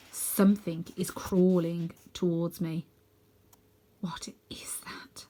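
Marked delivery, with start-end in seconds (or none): 4.0-5.2 slow frightened whisper; slow hushed whisper in fear. Acted. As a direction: breathe it, barely audible. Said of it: "I whisper".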